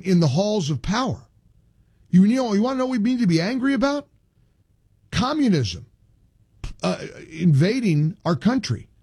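high-pitched, emphatic outrage with dramatic pauses; indignant rant. Real, not acted. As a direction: pitch it up and hammer out each phrase. Host rants about political opponents.

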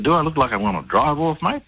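affronted, daring him to say yes; indignant retort. Retold or imitated. Customer confronting the servo attendant, indignant. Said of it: "as soon as he heard my tone of voice".

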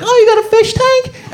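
very high-pitched mock delight; impressed visitor. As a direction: gush over it in a high voice. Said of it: "and be like".

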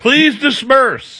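high, drawn-out mock official; mock crowd control. Co-host joining the Republican parody, slow mock-official announcement. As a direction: slowly shoo the crowd along, pitched high.

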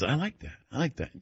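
soft, understated retold reply; quiet approval. Patient answering how he feels, quiet approval. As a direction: murmur it as the satisfied patient.

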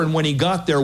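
ordinary delivery

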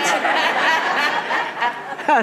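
a warm burst of laughter; real amused laugh; hearty laugh. Real, not acted. Pastor laughs at own joke.

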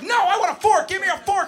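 put-on high, loud bratty toddler demand; screaming preschooler. Teacher mimics the boy yelling for a fork, high and loud. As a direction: yell it like a kid on the edge of a tantrum. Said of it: "It's like".

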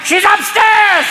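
high, drawn-out betraying shout; hammed-up stage yell, stretched long; mock shout. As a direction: call it out slowly and piercingly. Mock French stage production informer shouting.

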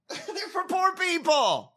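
high, drawn-out words through laughter; laughing mockery. Real, not acted. Host laughing at his own bitter punchline, laughing high mockery. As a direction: crack up on a slow, high-pitched punchline.

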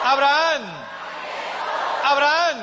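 high, loud call of a name; big ringing summons, high and loud. Acted. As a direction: shout it out to rouse the whole congregation. Calls out God's summons, congregation answers.

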